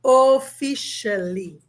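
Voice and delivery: "Officially" said slowly, "o-fi-sha-li"; slow English demo. Shown as a demonstration. Teacher models the English word, slow and clear. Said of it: "Me diz o que que significa officially".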